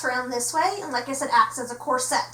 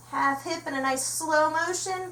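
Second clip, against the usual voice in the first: slow, softer guiding voice; 2 semitones higher, 3 dB quieter than usual. Shown as a demonstration. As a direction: draw it out gently, slowly.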